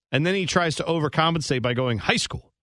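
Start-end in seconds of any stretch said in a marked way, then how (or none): 2.0-2.5 "high school" snapped out short and fast; imitated overcompensation, quick.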